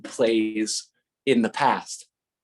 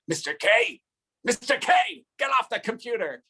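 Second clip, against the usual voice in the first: mock yelling; 12 semitones higher than usual. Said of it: "And I'll be like".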